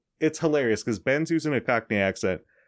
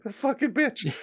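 mock Doctor outrage; 14 semitones higher than usual. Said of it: "He's like".

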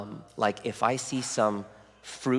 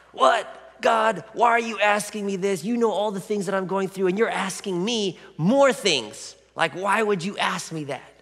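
high, loud mock indignation; incredulous protest; 8 semitones higher, 4 dB louder than usual. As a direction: protest to God in disbelief, pitched high. Imagined indignant question to God.

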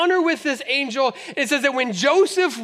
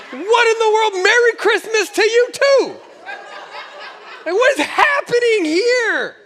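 higher, louder, laughing indignation; outraged disbelief; 7 semitones higher, 4 dB louder than usual. Retold or imitated. A coach protests a technical foul at the YMCA, laughing and outraged.

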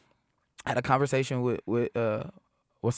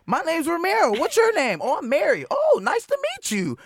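much higher, louder, eager meet-and-greet voice; mock friendly greeting; 14 semitones higher, 6 dB louder than usual. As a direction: greet a new fan with over-the-top friendliness. Acts meeting a fan at show.

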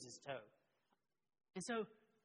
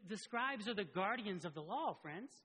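ordinary talk, nothing marked

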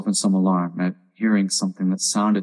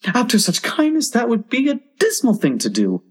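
very high oyster protest; small creatures in high-pitched dismay; oysters crying out; 13 semitones higher than usual. A narrator's reading. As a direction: plead in a piping little voice. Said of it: "the oysters cried".

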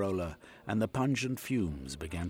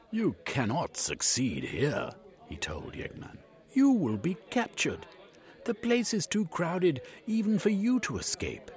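put-on raised, indignant old gent; defiant Cornucope; 10 semitones higher, 5 dB louder than usual. A narrator's reading. Cornucope tells Yegman he will be caught, indignant. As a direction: warn him with firm defiance.

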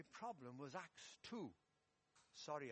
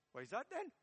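higher, louder Welsh-Geordie student; Welsh Geordie accent; 6 semitones higher, 5 dB louder, faster than usual. Acted. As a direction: question back in a lilting Welsh-Geordie accent. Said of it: "a Welsh Geordie accent".

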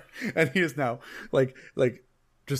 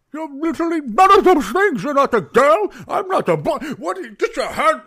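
much higher and much louder, a barman losing it; furious outburst; 9 dB louder than usual. Acted. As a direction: shout him down in pure exasperation. Bartender blows up at the player.

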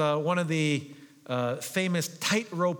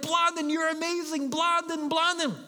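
cheering crowd; 11 semitones higher than usual. Retold or imitated. Crowd cheering Blondin after he crosses, high excited shout. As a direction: cheer him on like a wild crowd. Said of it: "people are screaming and they're shouting".

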